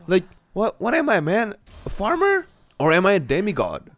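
high, disbelieving mock outrage; incredulous. Real, not acted. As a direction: act baffled at the idea of farming. Mock outrage at gathering tools.

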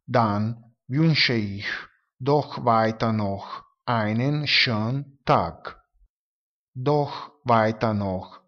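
slow, over-articulated German; slow teaching pace. Shown as a demonstration. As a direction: spell out each word slowly for learners. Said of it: "pronunciarle in modo lento". (tedesco lento e scandito)

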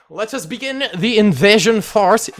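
hammed-up French general's accent. Gamer playfully starting the invasion in a mock French accent. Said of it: "French accent".

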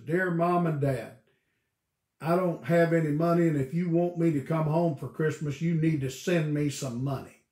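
loud, blunt and pushy; a son bluntly demanding money, read out loud; rude demanding letter. Retold or imitated. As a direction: read it like a curt demand for cash. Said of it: "a lot of meaning in how things are said".

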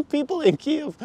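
high laughing disbelief; incredulous laugh. Real, not acted.

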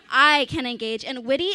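ordinary talk, nothing marked

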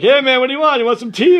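high, loud casual greeting; mock hippie owner; laid-back owner. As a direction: offer tea like a hippie.

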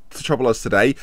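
ordinary talk, nothing marked

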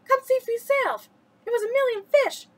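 put-on high, loud, excited boy; boy urging his friend to come see; all excited. A narrator's reading. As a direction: burst out with the news. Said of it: "He was talking all excited".

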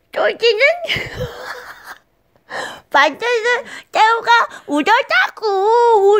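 very high, loud squeaky put-on voice; old impression. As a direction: squeal it out in a silly cartoonish voice. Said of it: "이 성대모사를 보면서". (아주 높고 과장된 성대모사 목소리)

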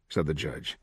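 plain reading voice

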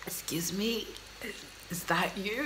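soft, near-whispered stunned question; hushed awe. Retold or imitated. An onlooker stunned by Tyra, barely audible. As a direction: ask it under your breath, dumbstruck. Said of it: "leaves you going like this".

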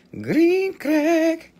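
very high squeaky green crack. Pretend. Seller hyping the green crack, high squeak. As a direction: squeak the name for fun. Squeaky repeated product name.

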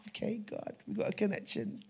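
acted whispered reply to God; hushed prayer. Preacher acts out answering God's presence, hushed whisper.

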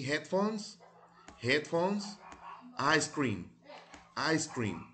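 "Headphones" lifted high, then "ice cream" said twice, clearly; model pronunciation. Shown as a demonstration. Teacher drilling vocabulary, clear model words. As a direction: give each word twice as a model to copy. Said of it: "Repeat after me".

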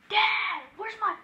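loud yell to another room; yelling across the room. Acted. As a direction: shout for dad far away. Calling dad from another room.

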